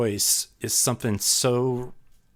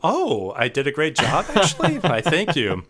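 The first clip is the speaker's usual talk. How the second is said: higher, playfully self-satisfied; mock pride; 6 semitones higher, 3 dB louder than usual. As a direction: act out a child suddenly pleased with himself. Acts out sudden pride after praise.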